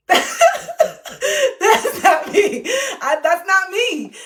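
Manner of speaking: high, loud words bursting through laughter; laughing. Real, not acted. Author rejecting the professional reading, laughing loudly. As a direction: crack up and draw it out. Cracks up at her demo.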